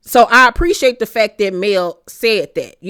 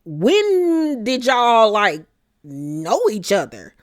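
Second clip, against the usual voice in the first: suspicious Stormy; much slower than usual. Retold or imitated. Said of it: "So Stormy was like".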